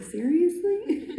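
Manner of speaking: high, slow, laughing "seriously?"; amused disbelieving squeal; incredulous. Retold or imitated. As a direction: stretch it out in mock disbelief. Retelling her own disbelieving reaction.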